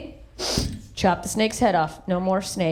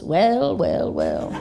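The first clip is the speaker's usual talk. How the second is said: villain gloat; much slower than usual. Retold or imitated. Son arriving at dying dad's bed, slow mock-villain gloat. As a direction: savor it like a cartoon villain.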